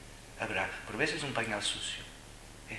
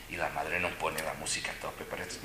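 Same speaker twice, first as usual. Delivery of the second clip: ordinary talk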